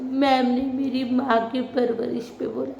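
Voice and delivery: hammed-up tattling schoolgirl voice; mocking Tanya's complaint. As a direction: parody her tearful grievance, half laughing. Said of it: "तो तान्या बता रही".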